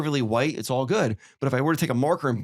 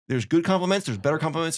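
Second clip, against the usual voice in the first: ordinary talk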